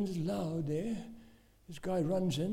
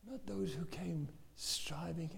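soft, slow, near-whispered preaching; hushed and deliberate; solemn hush; 9 dB quieter than usual. Real, not acted. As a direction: drop almost to a whisper.

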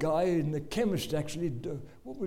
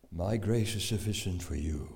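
voice of God; 11 semitones lower than usual. Acted. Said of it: "try it and see what happens".

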